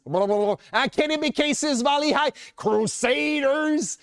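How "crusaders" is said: Crusaders said very slowly, every syllable effortful; struggling pronunciation. Shown as a demonstration. Francophone speaker showing how Crusaders trips him, very slow. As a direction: drag out Crusaders as if chewing it. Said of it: "ça tourne dans ma bouche".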